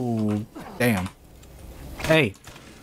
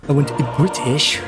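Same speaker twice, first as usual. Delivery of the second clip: higher, put-on British accent; mock British accent; 4 semitones higher, 4 dB louder than usual. Player poking fun at the accent, mock British. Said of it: "make fun of the accent".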